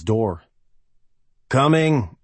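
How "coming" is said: growled. A narrator's reading. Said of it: "he growled".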